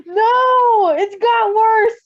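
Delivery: very high, loud laughing cry; laughing shriek. Real, not acted. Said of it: "cry shout".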